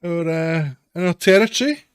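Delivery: loud, high, cheery gang boss; falsely friendly toll collector, big and bright; bright greeting. Acted. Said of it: "sort of greets you brightly".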